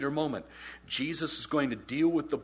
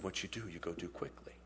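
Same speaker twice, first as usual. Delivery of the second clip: hushed, softer urgent words; whisper; 8 semitones lower, 15 dB quieter than usual. Retold or imitated. Jesus whispering to Judas, hushed. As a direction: lean in and whisper the order. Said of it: "Then he whispers to him".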